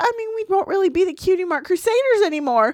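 very high anxious filly; panicky filly voice. Acted. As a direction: spiral into high worried questions. Voicing anxious Apple Bloom.

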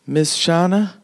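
a touch of sadness; acted sadness. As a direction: call out wistfully, in character. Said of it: "she looks so sad".

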